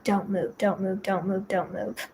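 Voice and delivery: fading chant to keep yourself still; hushed self-talk. Retold or imitated. Frightened patient repeats it to herself, fading murmur.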